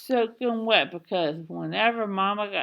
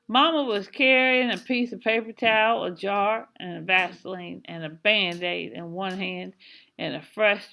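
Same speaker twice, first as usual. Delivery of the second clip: ordinary delivery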